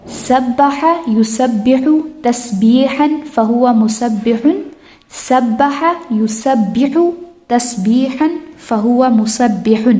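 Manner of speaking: measured, deliberate Arabic recitation; slow drill recitation. Shown as a demonstration. Teacher models a verb family for students, slow recitation. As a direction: recite the word family slowly for memorizing.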